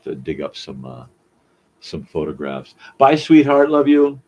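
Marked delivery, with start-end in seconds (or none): 3.0-3.7 high, loud sing-song goodbye; goodbye at the door. Real, not acted. Saying goodbye to family leaving. 3.7-4.2 high, loud loving call; goodbye at the door. Real, not acted.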